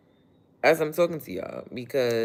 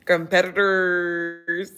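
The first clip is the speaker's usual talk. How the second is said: mock drawn-out word; 6 semitones higher, 8 dB louder, much slower than usual. Repeating the word playfully.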